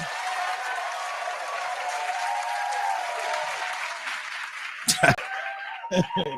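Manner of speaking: high silent wheeze of laughter; breathless laughter; wheezing laugh. Real, not acted. Cracking up at the twist, wheezing laugh.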